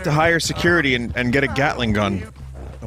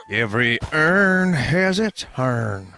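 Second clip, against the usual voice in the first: very slow, sing-song delivery; slow drawn-out pun; much slower than usual. Pretend. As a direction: stretch every word out. Repeats pun slower.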